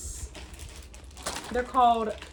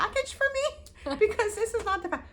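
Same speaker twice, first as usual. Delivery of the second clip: very high, laughing plea to the clerk; 12 semitones higher than usual. Retold or imitated. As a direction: beg the clerk, half laughing. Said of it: "and I'm like".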